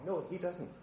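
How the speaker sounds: ordinary talk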